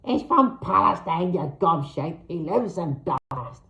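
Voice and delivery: mock high-pitched hag. A narrator's reading. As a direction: snap it in a high squawk. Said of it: "responded in a high pitch".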